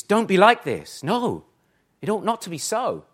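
loud, high and forceful, stressing not; emphatic warning. Retold or imitated. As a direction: hammer it home like a stern preacher. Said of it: "This is emphatic".

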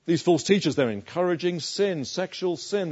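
normal talking voice

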